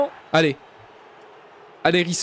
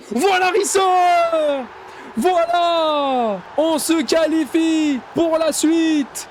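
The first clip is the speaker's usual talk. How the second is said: triumphant cheer; 6 semitones higher, much slower than usual. Real, not acted. Keeper saves, team qualifies.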